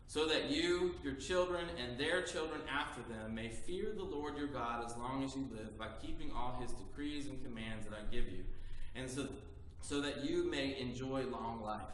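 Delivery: low, measured lesson reading; scripture reading. Acted. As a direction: read it like the Old Testament lesson. Reads Deuteronomy lesson aloud.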